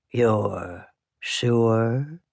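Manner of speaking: low, drawn-out creepy question; eerie salesman. A narrator's reading. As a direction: linger on it with a creeping smile. Eerie salesman answers refusal, creeping smile.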